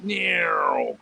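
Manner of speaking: high squealing meow standing in for the brakes; a playful cartoon skid noise; mock brake screech. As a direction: imitate tires screeching to a stop. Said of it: "slammed on our brakes and went".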